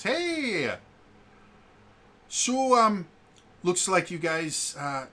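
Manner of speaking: put-on high, loud character greeting with the accent slipping; [name], accent lost. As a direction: hail the group, losing the accent. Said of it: "[name]'s accent is gone as well".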